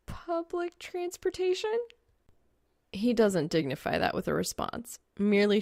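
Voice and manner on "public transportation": high teasing jab; snarky. Acted. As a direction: needle him with mock innocence. Snarky quip at his car.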